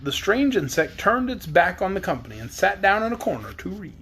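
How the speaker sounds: ordinary delivery, nothing marked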